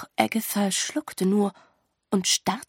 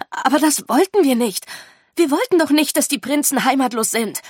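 loud, higher-pitched protest; calling out; 6 semitones higher, 7 dB louder than usual. A narrator's reading. Agatha protesting to the teachers about homeless princes, calling out. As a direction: cry out in protest. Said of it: "rief Agatha".

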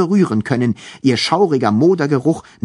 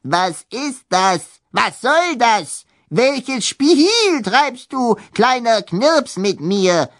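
indignant ghost baroness; 7 semitones higher, slower than usual. Acted.

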